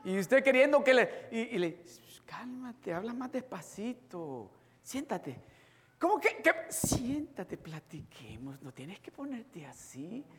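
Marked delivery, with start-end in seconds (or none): 2.2-5.4 much softer mock calming; soothing. Imitating someone soothing an angry person. 6.0-6.5 higher, taken-aback retort; indignant surprise. Retold or imitated. Angry person reacting to being calmed. 6.7-10.2 much softer mock calming; soothing. Imitating soothing cousin.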